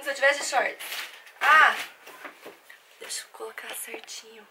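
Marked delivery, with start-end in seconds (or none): 3.0-4.5 hushed, fading to almost nothing; whisper. Real, not acted. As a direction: murmur to herself while arranging. Quietly setting up the camera.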